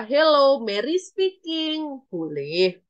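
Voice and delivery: normal talking voice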